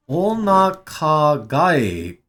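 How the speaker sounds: drawn-out, careful syllables; reading the Japanese slowly. Shown as a demonstration. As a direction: sound out onaka ga slowly. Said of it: "slow reading of onaka".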